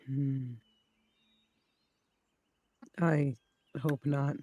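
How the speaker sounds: ordinary delivery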